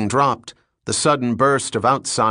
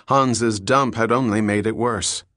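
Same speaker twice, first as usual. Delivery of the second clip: plain reading voice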